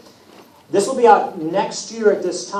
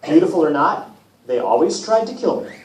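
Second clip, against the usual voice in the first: ordinary delivery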